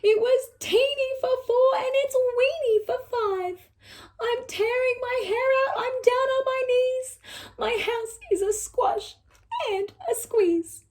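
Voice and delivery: high, wailing plea; an old woman at her wits' end; crying old lady. Acted. Lady cries out about her crowded house, high and wailing. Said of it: "The little old lady cried".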